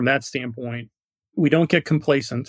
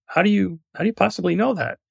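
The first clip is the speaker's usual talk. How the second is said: higher-pitched, mildly acted amazement; astonished question; 7 semitones higher than usual. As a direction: play the baffled man in a joke. Surprised joke character asks.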